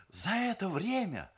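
high-pitched recital; bride's formal testimonial. A narrator's reading. Люда resuming her testimonial for her fiancé, reciting formally.

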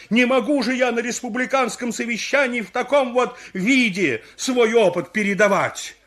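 high, loud complaint; indignant outburst. Acted. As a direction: protest loudly with wounded dignity. Indignant answer to foolish questions.

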